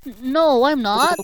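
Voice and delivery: loud, high stammered protest; desperate denial. Acted. Accused cheater protesting, desperate denial. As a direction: stumble into a pleading denial.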